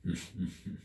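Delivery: low, soft creature chuckle; a sly quiet chuckle to itself; griffin chuckle. A narrator's reading. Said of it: "Then it chuckled".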